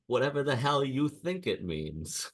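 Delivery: a hint of sitcom exasperation; Elaine from Seinfeld. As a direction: lightly imitate a sitcom retort. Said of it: "give him the Seinfeld, the Elaine".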